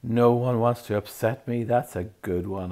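a hurt, mocking howl. A narrator's reading. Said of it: "howled Myrtle".

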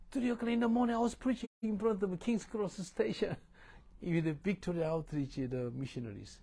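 excited preaching. Acted.

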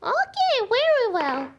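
high praising voice; kindergarten teacher voice. Acted. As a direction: praise the child warmly and high.

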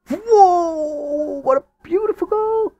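yelling with joy as his team scores; goal celebration. Real, not acted.

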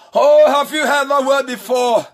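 ordinary talk, nothing marked

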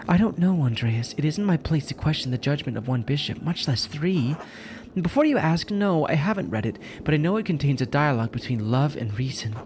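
high-voiced nun fending off questions; exasperated nun. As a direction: play a flustered religious sister.

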